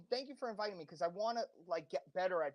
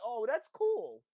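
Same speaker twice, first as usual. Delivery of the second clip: slow, higher, drawn-out approval; mock-impressed, louder and stretched out; mimicked impressed friends; 4 semitones higher, 5 dB louder, slower than usual. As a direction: imitate someone suddenly won over. Mimics friends won over at last.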